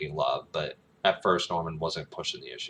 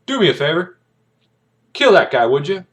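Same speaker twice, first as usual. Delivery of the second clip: raised, higher voice calling out an order; mock shouted order; 11 semitones higher, 12 dB louder than usual. Tank commander calling down to a villager, mock shouted order. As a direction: call it out loud across a street.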